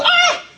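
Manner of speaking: sudden high yelp of fright; mock scream at a spider; startled shriek. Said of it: "and you go".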